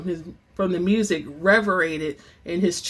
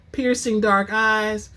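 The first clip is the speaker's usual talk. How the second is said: plain reading voice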